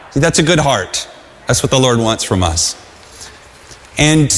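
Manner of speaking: ordinary delivery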